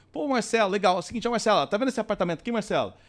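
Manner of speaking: slap his back and push the deal; buddy salesman pitch. Shown as a demonstration. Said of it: "se eu for falar de luxo e sofisticação". (vendedor camarada, bem mais agudo)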